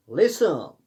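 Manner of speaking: लिसन said with no T sound; silent T. Shown as a demonstration. Teacher shows students the silent letter, T left out in लिसन. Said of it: "इसमें टी silent रहता है".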